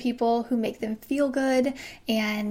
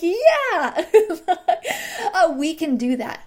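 much higher, giggling agreement; laughing yes; 6 semitones higher, 4 dB louder, slower than usual. Retold or imitated. Homeowner answering an eager builder, laughing. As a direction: laugh along with delight.

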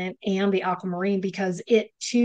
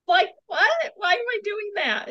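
amused disbelief; 12 semitones higher than usual. Real, not acted. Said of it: "It's just funny".

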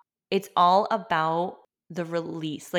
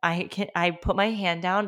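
ordinary talk

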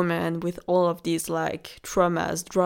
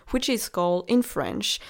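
normal talking voice

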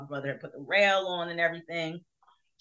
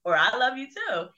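playful mom reply; 8 semitones higher, 5 dB louder than usual. Retold or imitated. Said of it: "she always answered me back".

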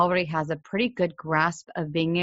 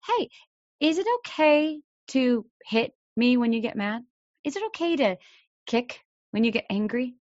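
put-on higher-pitched, gentle probing question to a child; curious parent voice, pitched up; parent asking child; 5 semitones higher than usual. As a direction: ask it lightly, like a casual check-in with your kid. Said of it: "ask your child".

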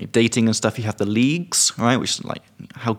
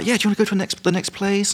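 put-on much higher, eager girlish voice; mimicking her; 10 semitones higher, faster than usual. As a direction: voice her high and keen to keep going. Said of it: "she was like".

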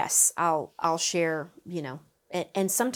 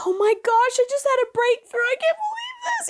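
much higher, excited mock student; excited breakthrough; 4 dB louder than usual. Coach imitates a student's excited breakthrough. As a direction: squeal it like a joyful breakthrough. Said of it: "type of tears where it's like".

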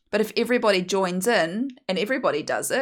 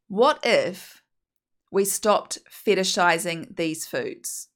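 usual speaking voice

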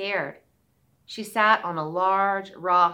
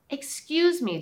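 put-on little girl voice; 7 semitones higher than usual. Girl asks cobbler.

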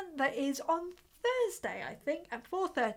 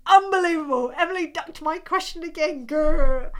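mock frustration; 5 semitones higher, 9 dB louder than usual. Reading out a frustrated chat comment.

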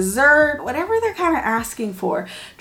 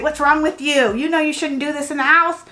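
a hint of an annoyed parent; mild mock scolding; 5 semitones higher, 3 dB louder than usual. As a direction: scold the kid lightly as what not to say. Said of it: "It's instead of".

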